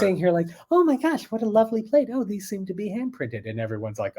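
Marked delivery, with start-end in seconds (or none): 0.7-3.5 very high, put-on dainty voice; mock gushing admiration. Guest admiring a hand-printed plate, high mock gushing. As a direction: coo over it in a high affected voice.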